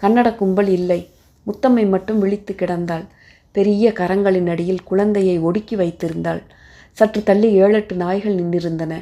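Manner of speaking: ordinary delivery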